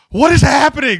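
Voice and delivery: high, loud, shrieking What is happening; a mock panicked squeal of confusion; cartoonish high-pitched shock; shrieked bewilderment. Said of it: "You just be like".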